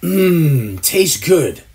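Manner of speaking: low hum like tasting food; mock food hum. Mocking a host who hums as if eating, low savouring hum. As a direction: savour a bite. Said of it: "you feel the need to say".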